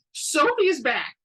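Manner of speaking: high, loud announcement; excited exclaim. Acted. Best friend revealing Sophie's return, high excited exclaim. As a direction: announce it with bated breath. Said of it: "Sandra exclaimed".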